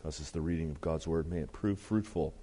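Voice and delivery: ordinary talk, nothing marked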